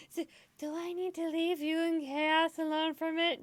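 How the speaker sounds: squeaky question between laughing fits; high amused voice. Real, not acted. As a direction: ask it high, barely holding it together. Asks mid laughing fit.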